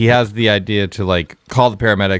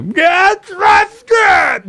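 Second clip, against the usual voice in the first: high, loud comic yell; Adam Sandler; 5 dB louder, much slower than usual. Acted. Casting Adam Sandler as the neighbor, high yelling impression. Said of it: "Adam Sandler as, like, a".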